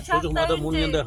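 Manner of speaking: loud high call. Real, not acted. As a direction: shout it across the field. Calling out across picnic.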